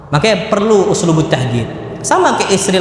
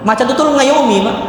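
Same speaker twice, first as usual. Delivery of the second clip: ordinary delivery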